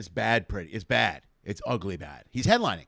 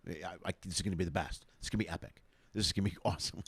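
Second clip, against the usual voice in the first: hushed and gleeful, telling a friend in the back of the room; hushed, eager whisper of a prediction; gleeful hushed aside; 10 semitones lower, 11 dB quieter, faster than usual. Retold or imitated. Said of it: "I go".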